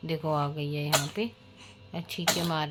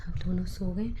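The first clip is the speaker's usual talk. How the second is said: ordinary delivery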